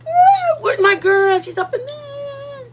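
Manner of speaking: light up as a friend arrives; squealing hello to a favourite viewer; excited greeting. Real, not acted.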